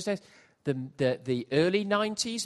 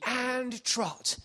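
trot command; 8 semitones higher than usual. Retold or imitated. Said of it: "it heard the".

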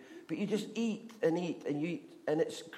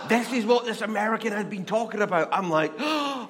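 high, loud laughing; loud excited laughter at the discovery; laughing excitement; 8 semitones higher, 8 dB louder than usual. Real, not acted. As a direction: laugh it out with glee. Excited at finding it.

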